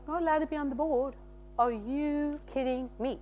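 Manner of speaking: very soft, indignant inner voice; hushed retold indignation; upset disbelief. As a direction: think it to yourself, quietly affronted. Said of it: "I was so upset".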